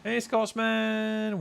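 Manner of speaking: high, slow sing-song hey; drawn-out greeting. Real, not acted. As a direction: stretch the greeting out warmly.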